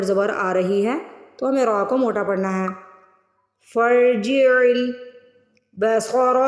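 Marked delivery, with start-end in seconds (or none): 3.7-6.4 فرجع البصر with fa joined to a heavy ra, very slow; slow Quranic recitation; slow tajweed recitation. Shown as a demonstration. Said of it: "صاد کی آواز نکالیں گے".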